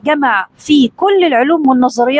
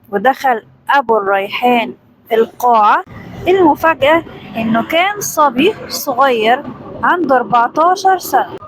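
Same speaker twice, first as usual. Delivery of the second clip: usual speaking voice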